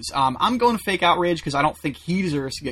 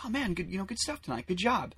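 much softer, half-hearted compliment; hushed polite praise; 3 semitones higher, 11 dB quieter than usual. Retold or imitated. Audience members telling the comic good stuff, hushed polite praise. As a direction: imitate audience members offering quiet praise.

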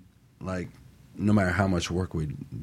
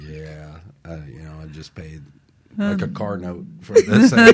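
laughing retort; 12 dB louder than usual. Retold or imitated. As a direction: tease it out while cracking up. Joking retort about ring cost.

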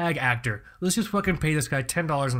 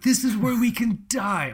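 happy; 9 semitones higher than usual. Retold or imitated. Buddy telling his wife he loves the house, gleeful and higher. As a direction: say it like joyful good news. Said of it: "he was happy about it".

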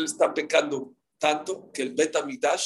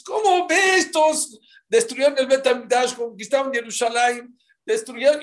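indignant protest; 8 semitones higher, 6 dB louder than usual. Acted. Friends protest to Akiva.

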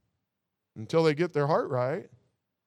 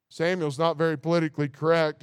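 ordinary talk

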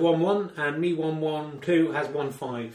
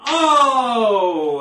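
surprised oh; 4 semitones higher, 9 dB louder than usual. Real, not acted. Ball wobbles, dramatic commentary.

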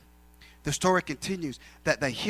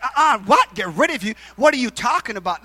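loud, high, incredulous questions; imitated bafflement; 6 semitones higher, 9 dB louder than usual. Imitating her answer to his fear, loud incredulous. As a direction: act out a boss utterly baffled. Boss's baffled reply acted out.

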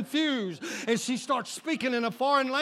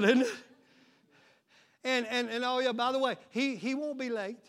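usual speaking voice

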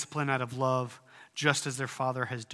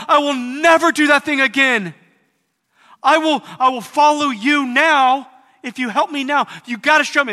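much higher, louder desperate shouted prayer; desperate plea; 12 semitones higher, 13 dB louder than usual. Acted. Busted person begs God for rescue, desperate shouting plea. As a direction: beg God at the top of your voice.

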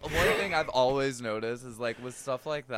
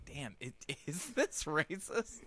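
inner doubt; 3 semitones higher, 8 dB quieter than usual. Retold or imitated. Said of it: "my brain almost thinks".

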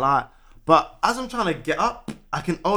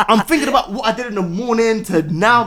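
normal talking voice

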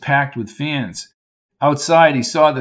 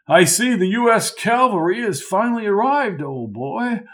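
lightly voiced old Lark, high and jolly; a hint of a jovial old-timer calling him old boy; hearty old college man; 7 semitones higher than usual. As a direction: play the chummy old coach, a touch higher.

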